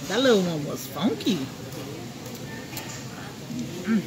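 ordinary delivery

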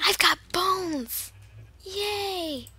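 loud, high, slow whispered exclamation; stage whisper. Pretend. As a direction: hiss it out gleefully.

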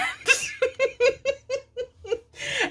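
bright burst of high giggling; high laugh. Real, not acted. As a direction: giggle at the irony. Laughs at being refused as donor.

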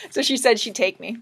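ordinary delivery

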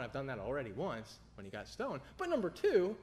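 normal talking voice

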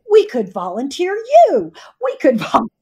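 high, loud and eager, breaking into a laugh; mock child's glee with a laugh; excited kid. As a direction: pitch it up like a thrilled twelve-year-old. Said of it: "And she said".

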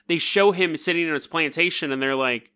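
ordinary talk

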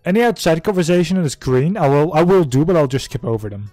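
loud. Real, not acted. Viewer talks over the scene.

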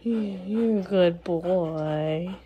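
very slow drawn-out praise. Real, not acted. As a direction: stretch each word lovingly.

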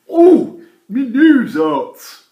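high, loud, slow whimper of pain; hurt guard. Acted. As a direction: moan over a bumped nose.

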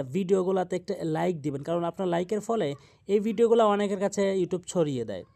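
ordinary talk

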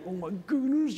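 high, hushed mock exasperation; inner panic. Preacher voicing what you feel inside behind the greeting, panicked. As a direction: squeal it softly, as if inside your head. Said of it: "But inside you're going".

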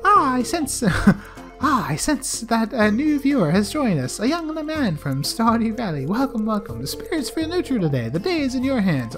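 high, mystical fortune teller voice. Acted. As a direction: intone it like a mystic seer. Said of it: "let's check with the fortune teller".

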